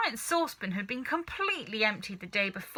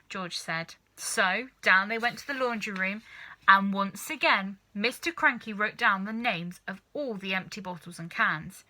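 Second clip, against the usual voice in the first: ordinary delivery, nothing marked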